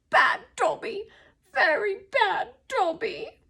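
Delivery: high elf voice, drawn-out reproach; self-scolding elf. A narrator's reading. Dobby punishing himself, high-pitched scolding. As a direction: scold yourself in a high creature voice. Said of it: "cross-eyed and muttering".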